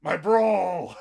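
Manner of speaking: loud hammed-up bro greeting; loud mock gangster cheer; yakuza bro shout. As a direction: bellow it like a thug greeting his pal. Girls switch to yakuza bro.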